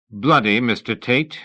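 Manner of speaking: loud, scolding schoolmaster warning; about to give a tongue-lashing; stern schoolmaster. A narrator's reading. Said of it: "a schoolmaster about to deliver a tongue-lashing".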